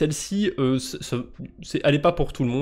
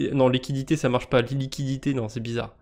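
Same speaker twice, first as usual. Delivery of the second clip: usual speaking voice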